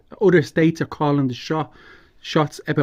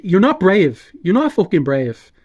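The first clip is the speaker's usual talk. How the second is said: angry; 5 semitones higher, 5 dB louder than usual. Real, not acted. Angry outburst at Klitschko.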